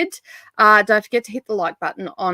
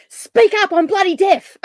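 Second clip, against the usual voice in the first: high, loud, hammed-up griping; mock deaf complaint; 11 semitones higher, 5 dB louder than usual. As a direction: play the grumpy friend who can't hear. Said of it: "I'll be in Voxer going".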